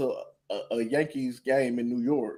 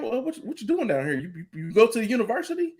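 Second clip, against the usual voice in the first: higher, quick nosy-stranger voice; imitating a curious stranger; 9 semitones higher, faster than usual. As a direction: play the eager fan who pesters him.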